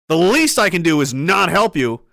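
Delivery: ordinary talk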